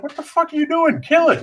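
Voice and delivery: high-pitched exasperated outburst, re-enacted; retold exasperation. Retelling his order to the man stepping on the mouse, exasperated. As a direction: act out appalled impatience, voice jumping up.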